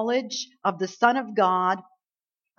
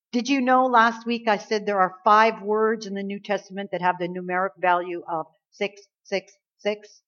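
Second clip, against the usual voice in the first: normal talking voice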